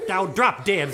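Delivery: emphatic, punched-out words; rude retort. Acted. Cursed sister spoiling mommy's joy, emphatic rude retort. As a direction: land on each word insolently.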